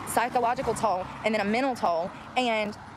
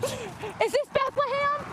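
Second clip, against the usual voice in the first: put-on whiny kid passenger; 14 semitones higher, much slower than usual. Pretend passenger on long drive.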